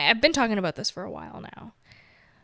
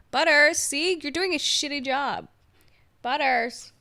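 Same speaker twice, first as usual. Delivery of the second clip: raised, higher playful call; calling the cat; 6 semitones higher, 6 dB louder, slower than usual. Real, not acted. Vlogger calling her cat co-host, playful raised call. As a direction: call out to the cat to scold him jokingly.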